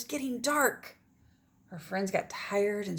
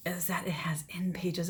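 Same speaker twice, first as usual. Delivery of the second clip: whisper; 3 semitones lower than usual. Real, not acted. Teacher aside whispered to class.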